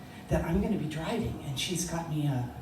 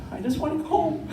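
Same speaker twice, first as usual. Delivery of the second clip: much higher, pleading repeat; pleading whine; 11 semitones higher than usual. Retold or imitated. Exhausted teen learner wanting out, pleading whine. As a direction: plead to be let off.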